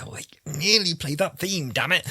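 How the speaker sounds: high, frustrated groan at a near miss; mock frustration. Fan complaining the theme almost played, high mock frustration. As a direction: play your own disappointed fan self. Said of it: "I'm like".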